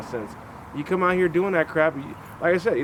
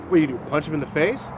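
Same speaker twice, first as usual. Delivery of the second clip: ordinary talk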